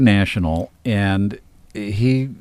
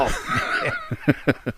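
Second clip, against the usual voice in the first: can't stop laughing; 4 dB quieter than usual. Real, not acted. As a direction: burst of real laughter. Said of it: "I can't stop laughing".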